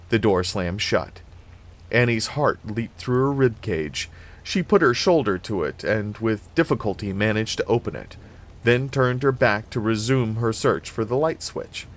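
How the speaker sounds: plain reading voice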